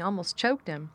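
plain reading voice

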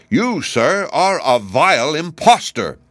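indignant and emphatic; harsh, indignant. A narrator's reading. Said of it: "a voice that sounded harsh and indignant".

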